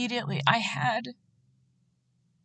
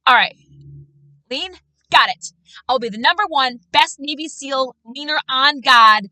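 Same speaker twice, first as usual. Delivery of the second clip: louder, eager gung-ho voice; mock overachiever; 3 semitones higher, 7 dB louder than usual. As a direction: mimic someone trying too hard to be the best. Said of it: "You're like".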